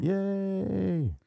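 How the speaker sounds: high, drawn-out yay; playful cheer. Pretend. Host echoes co-host at the happy ending, playful high cheer. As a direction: cheer along in a squeally high pitch.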